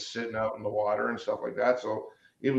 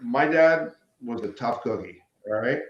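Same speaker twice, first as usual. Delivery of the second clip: ordinary talk, nothing marked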